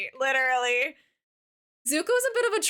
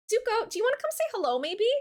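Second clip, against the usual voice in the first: much higher, fast coaxing call; coaxing pet-voice call; 8 semitones higher, faster than usual. Real, not acted. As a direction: coax the cat over. Calling her cat.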